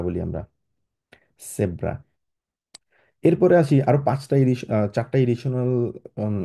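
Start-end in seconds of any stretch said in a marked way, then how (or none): 1.5-1.9 জেব্রা as a low "sebra"; German pronunciation. Shown as a demonstration. Teacher closing the z lesson, জেব্রা said "sebra". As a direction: start জেব্রা on s and let the pitch drop. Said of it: "এস এর মতো উচ্চারণ করি".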